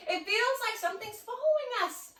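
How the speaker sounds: high, fretful cat voice; fussy cry. A narrator's reading. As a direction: whine it out nervously. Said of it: "Klaus cried out from the back with a fuss".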